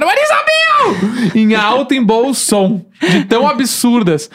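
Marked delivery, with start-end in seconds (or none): 0.0-1.3 stretched high mock cry; shouted exclamation. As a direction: call it out slow and high. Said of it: "me fazem gritar".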